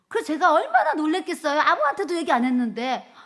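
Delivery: thrilled astonishment at the answered prayer; amazed. Real, not acted. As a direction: exclaim it to the congregation. Tells amazement at healing news.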